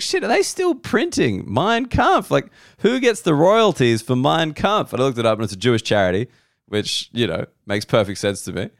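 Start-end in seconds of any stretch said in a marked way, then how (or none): 0.0-4.9 shocked. Retold or imitated. Retelling his shocked thought.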